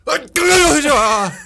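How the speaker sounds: loud high botched sneeze; strangled sneeze. Shown as a demonstration. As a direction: mess up a sneeze on purpose. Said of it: "I'll be like".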